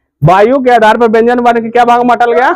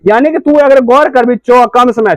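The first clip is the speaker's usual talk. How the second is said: ordinary talk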